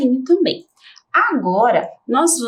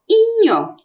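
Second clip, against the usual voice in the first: inho said much higher and louder; emphasized ending; 11 semitones higher, 4 dB louder than usual. Shown as a demonstration. Teacher stresses inho as the rhyme ending. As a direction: pop inho out high and loud. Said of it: "tem que terminar em".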